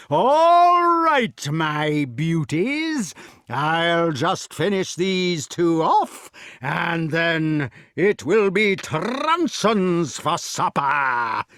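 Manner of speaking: very slow, drawn-out giant voice; threatening giant. A narrator's reading. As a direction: drag out the threat with relish.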